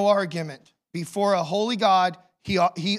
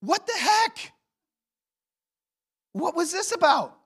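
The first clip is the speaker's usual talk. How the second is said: much higher, incredulous cry; exasperated disbelief; 10 semitones higher than usual. Retold or imitated. Abraham after the rescue, demanding of God in disbelief. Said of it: "I'm saying".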